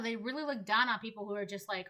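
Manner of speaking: ordinary delivery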